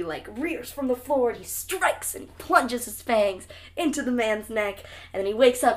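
ordinary delivery

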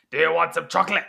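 loud attempted German accent. Shown as a demonstration. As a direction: try a German accent, loudly. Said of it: "that's not the right answer".

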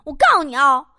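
loud, high, rapid threats; angry threatening. Acted. Girlfriend holding boyfriend's ear, warning him, angry threatening. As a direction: threaten him loudly, refusing to let go.

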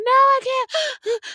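very high, slow, pleading no; a hammed-up panicked plea; panicked whine. Her panicked refusal in shower.

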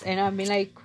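usual speaking voice

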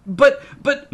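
high, loud, squeaky stammer; stammered protest. A narrator's reading. Scolded child tries to answer Dad, stammering in protest. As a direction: try desperately to get a word in.

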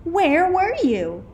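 put-on high, loud children's question; eager cry. A narrator's reading. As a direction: demand it like excited kids. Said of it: "cried all the kids".

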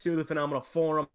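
loud showman announcer opening; booming circus ringmaster welcome. Acted. Host opens the show.

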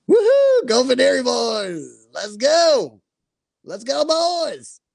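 rowdy high-pitched bro cheer; frat bro. Pretend. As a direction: whoop it up like a frat bro at a game. Cheering on the draft rivals.

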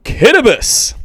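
Kittibus said KIT-ih-bus, with a t sound for the spelt r; Kittibus pronounced. Shown as a demonstration. Host gives the island's spoken name, KIT-ih-bus. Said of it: "as it's pronounced".

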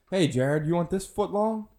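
lightly acted, voice climbing high; mock taunting offer. Inmate teasing the spokesman in jail, rising mock offer. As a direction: lift the pitch steadily through the question.

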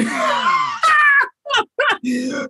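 squealing burst of laughter; real high-pitched laugh; high shrieking laugh. Real, not acted.

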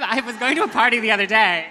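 loud, high, hammed-up third take; big third take. Shown as a demonstration. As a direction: belt it like a grand entrance. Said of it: "Should we do it a third time?".

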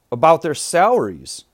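ordinary talk, nothing marked

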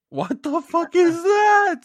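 loud, slow, high disbelieving yell; shocked shout. Real, not acted. Friend shouting at the duck picture, stunned. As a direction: blurt it out in horror.

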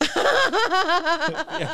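real laugh breaking through. Real, not acted. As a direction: burst of laughter on the word. Reacting to accent request.

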